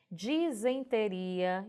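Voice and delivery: "Disenteria" drawn out slowly, starting DI-zen; correct pronunciation. Shown as a demonstration. Teacher presenting "Disenteria" to students, slow and correct. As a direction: stretch "Disenteria" with I first, EN second. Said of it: "a pronúncia dela também é diferente".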